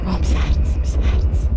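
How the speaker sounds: whispered, heart racing; breathless whisper. Real, not acted. Gamer after a scare, breathless whisper. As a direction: gasp it out under the breath. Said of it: "Ich bekomme eine Herzbaracke".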